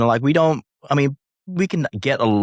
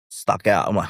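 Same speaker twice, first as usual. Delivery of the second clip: normal talking voice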